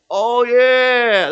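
loud, high, drawn-out stage voice; weird character voice. Retold or imitated. Actor remembering his cowboy voice mid-play, loud and high. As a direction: burst back into the cartoonish voice, stretched out. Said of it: "that weird voice that I can't do".

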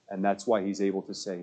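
ordinary talk, nothing marked

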